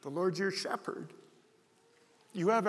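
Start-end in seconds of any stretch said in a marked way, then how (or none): 0.0-1.1 preschooler. Retold or imitated. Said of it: "there's my granddaughter".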